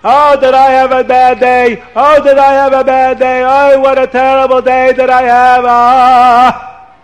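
high, loud, hammed-up wailing; wife fakes distress to her husband, wailing; wailing lament. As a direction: wail about a terrible day.

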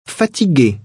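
ordinary talk, nothing marked

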